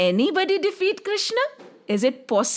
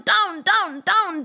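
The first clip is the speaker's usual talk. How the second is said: ordinary delivery, nothing marked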